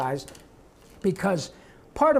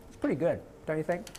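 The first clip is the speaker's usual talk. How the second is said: ordinary delivery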